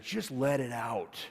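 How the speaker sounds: slow and gentle, offering himself; a calm father to his furious daughter; gentle invitation. Retold or imitated. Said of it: "turned my bald head into her like this".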